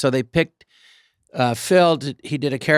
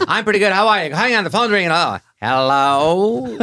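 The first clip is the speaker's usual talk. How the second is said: put-on higher, louder fast old-movie patter, slowing on hello; old movie fast talk; 5 semitones higher, 3 dB louder than usual. Spoofing old movies for his mom, old-movie patter. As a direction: play it like a forties phone scene. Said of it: "talk like that so fast".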